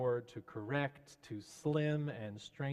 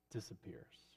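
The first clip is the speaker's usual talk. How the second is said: whispered; 4 semitones lower, 11 dB quieter than usual. Real, not acted. Trailing off, softly.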